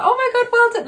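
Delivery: high, loud imitation of proud parents; parents' praise. Parents congratulating her on the book, high excited imitation. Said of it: "they both were like".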